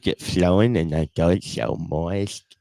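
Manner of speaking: slow, slurred puppet talk; put-on side-mouth puppet drawl. Shown as a demonstration. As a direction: drag it out like a worked puppet. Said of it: "That's just how I talk".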